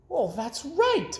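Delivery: high, slow, agreeing lady voice; drawn-out agreeing; old lady voice. A narrator's reading. Missus McGillicuddy agreeing with the boy, high and slow. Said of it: "said Missus McGillicuddy".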